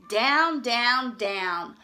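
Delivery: falling, fading descent; fading downward. A narrator's reading. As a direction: let it drop away. Sliding down the beanstalk.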